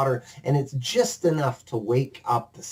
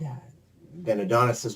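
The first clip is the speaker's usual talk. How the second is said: normal talking voice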